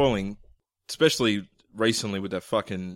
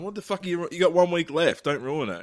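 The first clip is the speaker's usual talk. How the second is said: wife's mock telling-off; 7 semitones higher than usual. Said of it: "his wife's giving it".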